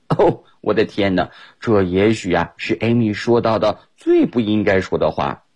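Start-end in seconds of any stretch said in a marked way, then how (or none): none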